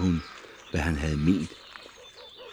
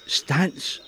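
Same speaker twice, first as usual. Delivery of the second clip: high cry of stop; higher, calling out to halt them; shouting stop; 9 semitones higher than usual. A narrator's reading. Hanna cries out after the prisoners, high. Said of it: "råbte hun".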